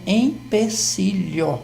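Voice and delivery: "empecilho" slowly, syllable by syllable, with clear E and final O; careful correct pronunciation. Shown as a demonstration. Professor giving the correct word, careful slow "empecilho". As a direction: spell out "empecilho" by sound, slow and distinct. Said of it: "a palavra certa é".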